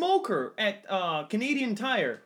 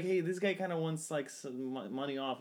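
quiet retold clerk; 4 semitones lower, 8 dB quieter than usual. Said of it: "he's like".

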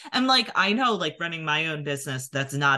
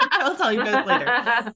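laughing; 10 semitones higher, 6 dB louder than usual. Real, not acted. Laughing off a teasing request.

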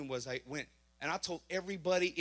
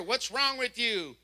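scolding cop; 4 semitones higher, slower than usual. Retold or imitated. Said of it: "I hear her".

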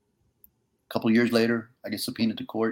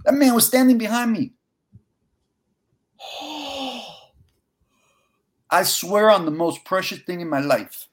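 high, choked-up tearful voice; fighting back real tears; 8 semitones higher, 4 dB louder than usual. Real, not acted. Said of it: "I got tears coming out of my eyes".

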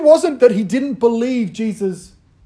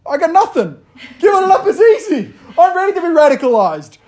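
put-on higher and louder, eager teen; eager teenager; 8 semitones higher, 5 dB louder than usual. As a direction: throw it off like a keen fifteen-year-old. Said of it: "they're like".

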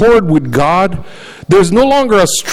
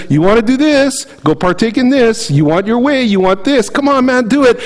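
higher, coaxing tempter voice; tempting voice of sin; 6 semitones higher than usual. Acted. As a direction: lure them in like sin whispering dares. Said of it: "It says".

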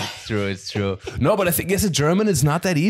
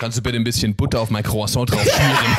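lower, matter-of-fact native German; real German, pitched lower; 4 semitones lower than usual. Shown as a demonstration. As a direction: ask it in brisk everyday German. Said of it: "How would you do it?".

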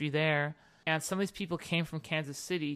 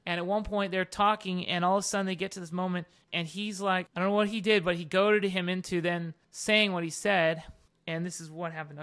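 ordinary talk

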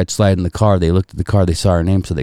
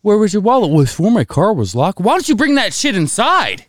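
exasperated scolding; 10 semitones higher than usual. Retold or imitated. Dad scolding daughter.

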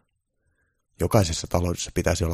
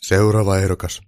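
loud, firm call for the next one; shouted call; 2 semitones higher, 9 dB louder than usual. A narrator's reading. Santa summoning the next job candidate, shouted call. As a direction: call out to the waiting room. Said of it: "joulupukki huusi".

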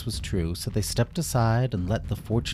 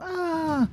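alarmed scream. Acted. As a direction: scream in fright at the blast. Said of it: "Mimi started screaming and crying out in alarm".

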